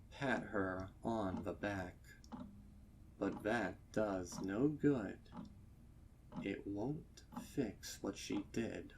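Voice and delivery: usual speaking voice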